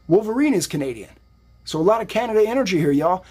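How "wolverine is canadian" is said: usual speaking voice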